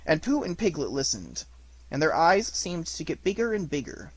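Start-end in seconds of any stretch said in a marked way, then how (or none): none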